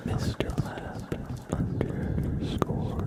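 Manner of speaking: very slow whisper; ASMR whisper. Acted. Tracing his own name for chat, slow whisper. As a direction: drag the syllables out in a whisper.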